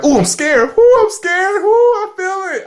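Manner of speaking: high, hammed-up squeals of fear, half laughing; mock scared. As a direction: play at being spooked by a horror movie. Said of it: "and be like".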